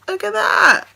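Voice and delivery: high, loud burst of delight; amazed exclamation. Real, not acted. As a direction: exclaim in real wonder, pitch leaping up. Amazed on opening the gift.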